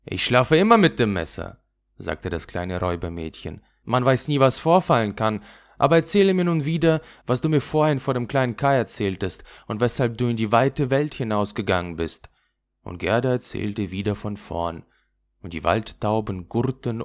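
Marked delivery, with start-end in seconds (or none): 0.0-1.6 lightly voiced wild robber girl, boastful and louder; a hint of a rough little robber girl showing off. Räubermädchen, trotzig. 3.8-12.3 lightly voiced wild robber girl, boastful and louder; a hint of a rough little robber girl showing off. Räubermädchen fordert die Geschichte.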